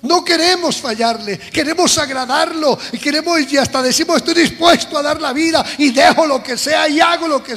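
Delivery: full-force preaching. Acted. Preacher proclaiming Jesus' promise to the church, fervent and loud. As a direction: preach it out to the congregation. Preacher voices believers' anguish.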